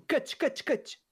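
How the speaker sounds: high-pitched insistent repeat; neighbor's warning. Retold or imitated. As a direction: urge him to cut the tree. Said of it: "falou assim". (vizinho insistindo para cortar, bem agudo)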